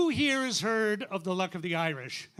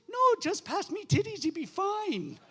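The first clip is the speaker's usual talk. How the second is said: blithe Irish woman, pitched way up; high punchline voice, sailing up then dropping at the end; Mother McCree; 11 semitones higher than usual. Acted. Irish woman answering the priest's question, blithe high voice. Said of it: "And she goes".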